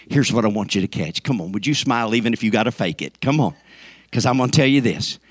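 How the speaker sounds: normal talking voice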